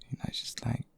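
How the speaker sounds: breathy lover's whisper; intimate whisper. Acted. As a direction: whisper it close to the ear. Tender confession to a lover.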